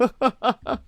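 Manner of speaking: short amused laugh from the wolf; a quick chuckle at the quip; Rannoch laughs. A narrator's reading. Rannoch laughing at the human's quip, a brief amused chuckle. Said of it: "My quip causes Rannoch to laugh".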